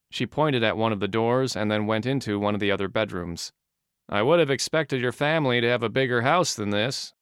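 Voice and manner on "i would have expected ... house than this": plain reading voice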